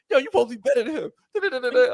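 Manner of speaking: high, rapid-fire retold scolding; mock scolding parent. Parent ribbing a son losing at basketball, mock scolding. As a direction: rattle off a high-pitched mock telling-off.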